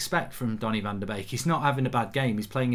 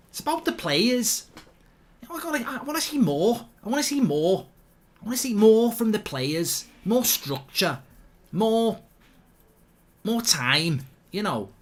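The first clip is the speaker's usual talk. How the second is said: mock pundit rant; 7 semitones higher than usual. Mock pundit rant about players.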